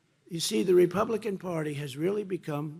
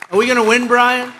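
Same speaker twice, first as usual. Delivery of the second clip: calling out; 7 semitones higher, 14 dB louder than usual. Real, not acted. Calling to crowd member.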